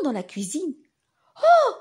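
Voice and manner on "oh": high, loud surprised gasp of a word; surprised cry. Acted. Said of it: "s'écria la maman".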